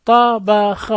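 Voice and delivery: طبخ split into three separate open syllables; sounded out syllable by syllable. Shown as a demonstration. Teacher drilling short vowels, sounded out syllable by syllable. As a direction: give each letter of طبخ its own beat.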